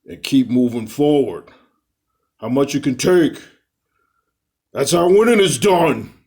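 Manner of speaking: boxer's rallying speech, building to a high shout; Rocky Balboa. Acted. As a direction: belt out the pep talk in a Stallone impression. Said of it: "that's my Sylvester Stallone impersonation".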